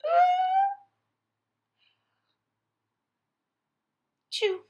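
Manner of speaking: a high wind-up, then a sneeze. Acted. Gerald sneezes.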